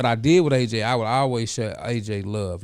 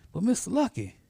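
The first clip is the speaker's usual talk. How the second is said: put-on high voice as the young man reminding him; voicing the student; 5 dB louder than usual. Former student reminding his mentor, in a raised playful voice. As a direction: play the grown student, pitched up and pleased.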